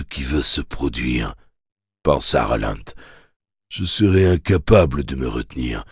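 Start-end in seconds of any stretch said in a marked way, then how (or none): none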